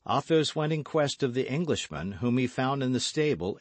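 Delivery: usual speaking voice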